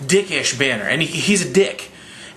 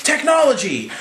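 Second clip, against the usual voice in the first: high-pitched superhero catchphrase; hammed-up heroic entrance; mock battle cry; 11 semitones higher than usual. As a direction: sing out one word triumphantly. Voicing Iron Man flying in.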